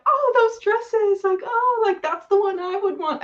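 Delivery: high, slow, dreamy gushing over dresses; gushing girl. Retold or imitated. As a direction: swoon over the dresses like a starry-eyed girl.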